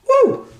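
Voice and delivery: short, very high "woo"; high whoop. Real, not acted. Cook signs off a cooking step to viewers, high whoop. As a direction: squeal a quick falsetto woo.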